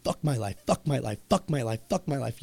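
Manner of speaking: low, soft repeated grumble; muttered self-talk. Acted. Listener sitting there repeating it to themselves, muttered self-talk. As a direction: chant it quietly under your breath, dropping low.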